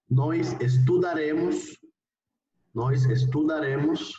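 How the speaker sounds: nós estudaremos drawn out slowly; slow classroom dictation; slow model pronunciation. Shown as a demonstration. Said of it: "la conjugación en futuro sería".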